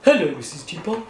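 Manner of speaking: low, cheerful egg voice; friendly Humpty; low Humpty Dumpty. Acted. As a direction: greet her as a chirpy egg. Humpty voice greets teapot.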